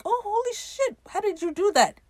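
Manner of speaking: very high, awestruck exclamation; mock amazement. Ed amazed by the severed finger trick, mock amazement. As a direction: imitate an astonished spectator way up high.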